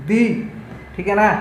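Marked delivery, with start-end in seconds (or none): 0.1-0.2 "दी" said as a long "dee"; strong form before vowels. Shown as a demonstration. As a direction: say "दी" with a clear ee vowel. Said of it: "इसको पढ़ेंगे".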